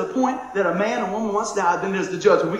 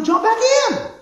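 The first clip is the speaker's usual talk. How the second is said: high, loud jump back in; rally them, loud and high; a preacher's loud, high cry; exhorting shout; 7 dB louder, slower than usual. Acted. Preacher exhorting at full force.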